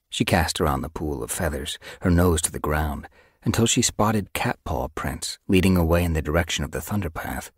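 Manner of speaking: ordinary delivery, nothing marked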